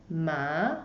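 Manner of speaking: "ma" starting low and rising; second tone ma. Shown as a demonstration. Teacher showing the second tone, "ma" rising. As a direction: lift "ma" upward like a question.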